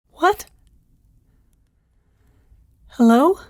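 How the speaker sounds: usual speaking voice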